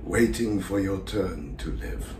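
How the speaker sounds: slow, drawn-out theatrical invocation; hushed-night monologue, stretched and deliberate; lonely Salieri, invoking the audience. Acted. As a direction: summon unseen ghosts slowly, with long pauses. Rehearses monologue in character.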